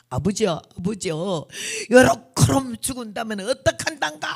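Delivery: imitated Jeolla prayer building to a high, loud plea; retold desperate plea with a Jeolla accent; Jeolla dialect prayer. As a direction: cry out to God in Jeolla dialect. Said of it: "전라도 사투리로 기도를 하는데".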